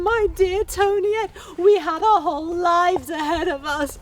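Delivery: high, loud, hammed-up sobbing lament; wailing widow. Wife mourning her living husband, loud high wailing lament. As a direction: wail it like a melodramatic widow. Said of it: "weeping and sobbing inconsolably".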